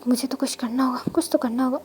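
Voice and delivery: whispered to himself; husband whispering his private resolve. Acted. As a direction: murmur the resolve under your breath. Wang resolves alone, under breath.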